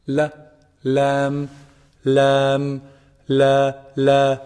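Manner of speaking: "lam" held level, tongue tip on upper gums; lam without ghunna. Shown as a demonstration. Teacher warning against a common mistake, clean held "lam". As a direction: say "La" and "lam" with no nasal hum in the l. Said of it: "no ghunna in your lam".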